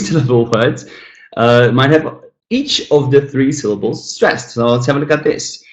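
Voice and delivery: ordinary talk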